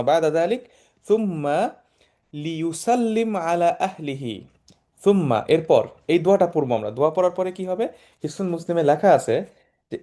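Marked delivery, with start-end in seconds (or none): none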